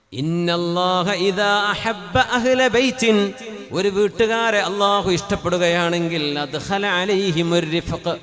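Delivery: loud, sing-song chanted sermon; preaching at full force. Acted. Preacher exhorting the congregation about Allah's sign, loud chanted preaching. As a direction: declaim it to the whole mosque.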